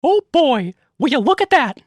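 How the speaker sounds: high falsetto cartoon mouse voice; Mickey Mouse voice. Shown as a demonstration. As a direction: pitch it way up like a cheerful cartoon mouse. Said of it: "my Mickey Mouse impression".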